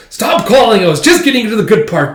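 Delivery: high, loud furious yell into the phone; angry shout. Retold or imitated. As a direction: snap at the caller. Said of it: "he angrily picks it up and goes".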